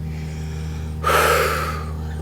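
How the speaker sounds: long unvoiced exhale; deep sigh. Shown as a demonstration. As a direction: let a long breath out with a sigh. Demonstrates letting out a deep breath.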